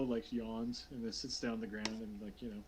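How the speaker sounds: ordinary delivery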